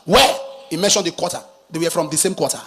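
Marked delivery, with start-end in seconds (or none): 0.1-0.4 loud, sharp rising "Where?" in shock. Acted. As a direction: blurt "Where?" out in disbelief. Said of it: "The man shocked".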